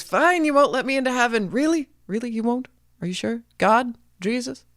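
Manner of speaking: can't believe he's being refused; indignant Jack. A narrator's reading. Jack refused heaven, calling on God, high incredulous whine.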